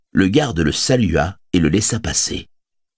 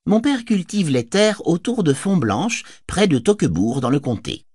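lightly higher, friendly hobbit talking; a cheerful hobbit chatting; hobbit Pippin; 11 semitones higher than usual. Acted. Narrator voices Pippin.

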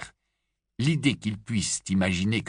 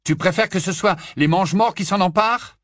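higher, louder challenging Bill; raised, pointed; Bill's retort; 10 semitones higher, 6 dB louder than usual. A narrator's reading. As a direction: throw the question back at her.

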